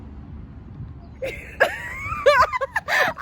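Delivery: squealing laugh; high-pitched burst of laughter. Real, not acted. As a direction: break into a high giggle. Laughs at her own story.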